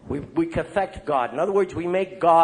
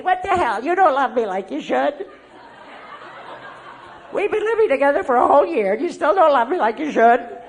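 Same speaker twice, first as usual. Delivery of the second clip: higher, aggrieved whine, half laughing; mock whiny complaint; 8 semitones higher than usual. Partner complaining to live-in lover, mock whiny and higher. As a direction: sulk like a let-down partner. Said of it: "I'd say".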